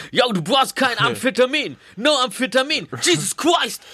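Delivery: put-on high, slow American accent over German. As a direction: talk German like an excited American. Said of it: "als ob ich amerikanischen Akzent hätte".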